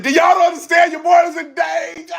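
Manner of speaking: high, loud alarmed plea; pleading loudly with the crowd; mock panicked shout. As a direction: shout it like you're really in danger. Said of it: "I was like".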